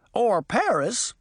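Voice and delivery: high, slow piggy voice; oinking. A narrator's reading. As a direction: say it like an excited pig. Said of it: "he oinked".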